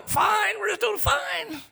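very high, slow sing-song reassurance; hammed-up everything-is-fine front; forced cheerfulness. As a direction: insist on it in a strained high voice. Acting out a false front.

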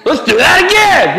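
put-on high, eager child's cry; excited kid. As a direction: play the boy thrilled by the wave. Son delighted after the wave.

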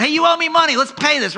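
high, loud bullying demand; hammed-up thug grabbing a debtor; menacing demand. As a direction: shake him down for the money. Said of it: "grabs him by the throat".

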